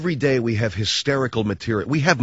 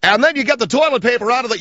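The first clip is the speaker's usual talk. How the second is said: high cartoonish mock voice; Comb Over Boy voice; 9 semitones higher, 4 dB louder than usual. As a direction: ask it in the silly high voice. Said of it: "a voice like it's completely out of a cartoon".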